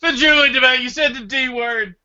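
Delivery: loud, high teasing with a laugh; excited teasing. Real, not acted. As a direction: gleefully call him out. Teasing about Druids.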